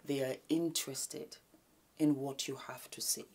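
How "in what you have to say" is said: soft unvoiced whisper. Shown as a demonstration. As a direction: hush like at a gala. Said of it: "I do that a lot".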